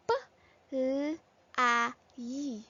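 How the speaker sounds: P as "puh", L as "lll", A as "ah", Y as "yee"; letter sounds. Shown as a demonstration. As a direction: sound out "P, L, A, Y" one by one. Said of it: "which sounds".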